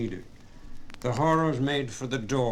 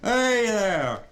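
loud, high yell after the thieves; angry shout; 9 semitones higher, 5 dB louder than usual. Acted. Carman shouts after the lads stealing his car, loud high yell. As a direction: bellow after them down the road. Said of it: "they heard him cry".

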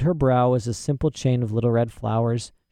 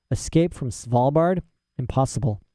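ordinary delivery

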